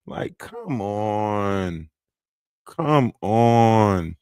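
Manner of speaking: low, slow disbelieving sigh; exasperated. Real, not acted. Streamer groaning at the rival's antics. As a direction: drag it out in disbelief.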